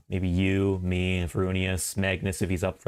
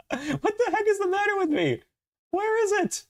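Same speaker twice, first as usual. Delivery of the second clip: very high, laughing wail; embarrassed laughter. Real, not acted. Streamer cannot find the emote, laughing in embarrassment. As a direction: squeal it through helpless laughter. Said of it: "shows off my embarrassment".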